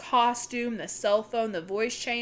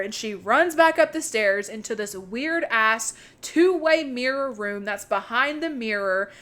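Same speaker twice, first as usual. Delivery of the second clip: plain reading voice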